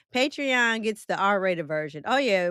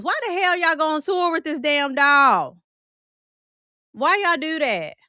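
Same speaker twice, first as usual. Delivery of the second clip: loud, rising incredulous protest; exasperated outcry; 6 semitones higher, 8 dB louder than usual. Real, not acted. Host asks why they toured with the Annabelle doll, exasperated outcry. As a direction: demand an explanation, loud and baffled.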